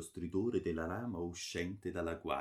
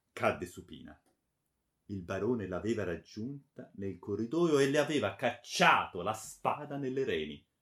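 ordinary delivery

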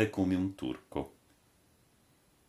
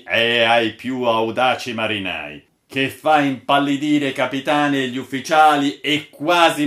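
dramatic declaiming; 4 semitones higher, 7 dB louder than usual. A narrator's reading. Old sailor declaims his tale.

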